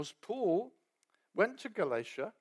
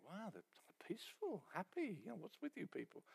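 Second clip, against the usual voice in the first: hushed wonder; 3 semitones higher, 15 dB quieter than usual. Retold or imitated. Newcomer marvelling, hushed.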